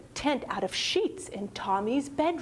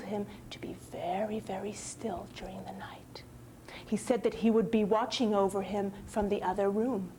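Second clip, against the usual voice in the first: hushed bedtime whisper; 4 dB quieter, faster than usual. A narrator's reading. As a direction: whisper the narration softly. Narrator drops to whisper.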